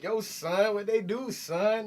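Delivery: high, loud mock New York voice, kids clowning; hammed-up New York "Yo, son"; mocking New York accent. Kids mock the New York kid, loud and high. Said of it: "they'd be clowning me".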